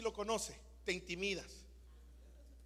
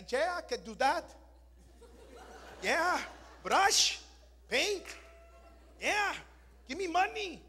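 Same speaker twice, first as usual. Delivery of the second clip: higher, lightly mocking eager English; 6 semitones higher, 5 dB louder than usual. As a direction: play a keen immigrant handyman. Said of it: "Fueron delante de los americanos". (imitando al trabajador entusiasta)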